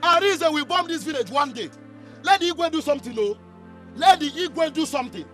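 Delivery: loud, heated complaint; indignant rant. Acted. Villager demanding the Igwe act against Arinze, indignant rant.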